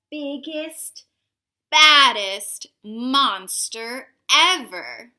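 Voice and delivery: slow booming climax; big dramatic boom. A narrator's reading. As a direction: land it like a thunderclap. Climax of monster making.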